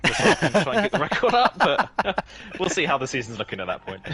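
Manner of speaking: high, loud laugh while joking. Real, not acted. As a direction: crack up at own joke. Jokes about losing on purpose.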